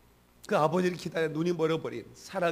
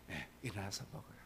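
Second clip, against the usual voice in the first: soft gentle repeat; 6 semitones lower, 16 dB quieter than usual. Acted. Repeats angel's words softly.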